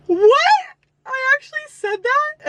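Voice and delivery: high, shocked What?; shocked disbelief. Real, not acted. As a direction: gasp at your own words. Shocked at own writing.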